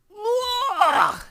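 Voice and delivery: high loud retching ugh; disgusted ugh. A narrator's reading. Sophie spitting out the Snozcumber, high loud ugh. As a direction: sputter as the taste hits. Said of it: "she sputtered".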